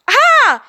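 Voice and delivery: loud shrill scream; high scream. Acted. Brittany screams as the creature hits, high and loud. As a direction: scream in terror.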